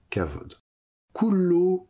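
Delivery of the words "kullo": "Kullo" said high and louder; emphasized word. Shown as a demonstration. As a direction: punch "Kullo" as the word on the board. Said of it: "ça veut dire tous". ("Kullo" appuyé, voix haute)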